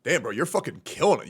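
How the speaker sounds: ordinary talk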